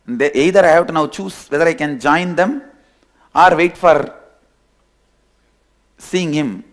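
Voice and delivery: ordinary delivery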